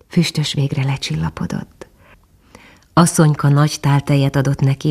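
ordinary delivery

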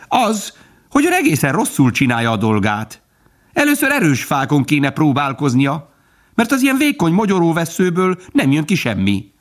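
high-pitched, lecturing woodpecker. A narrator's reading. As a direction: criticise in a high, know-all bird voice. (magas hangú, kioktató harkály)

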